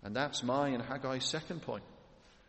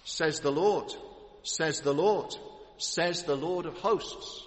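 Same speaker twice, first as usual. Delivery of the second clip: louder, each "Lord" leaned on, last phrase slowed; for emphasis; 3 semitones higher, 4 dB louder, slower than usual. Shown as a demonstration. Preacher stressing God's threefold name to the congregation, emphatic and building. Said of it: "for emphasis".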